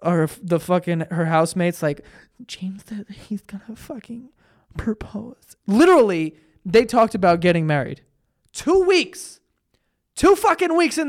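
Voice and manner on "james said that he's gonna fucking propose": secretive whisper. Retold or imitated. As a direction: whisper the big news to housemates. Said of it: "she's fucking whispering".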